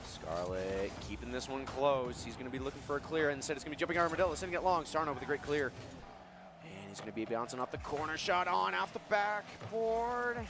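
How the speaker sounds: high, excited esports commentary; live play-by-play. Acted. Co-caster follows saves and shots, excited play-by-play. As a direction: ride each shot up in pitch, then settle.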